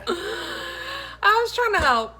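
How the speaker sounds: high, loud laughing defense. Real, not acted. Wife defending herself while laughing high and loud. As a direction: plead while cracking up.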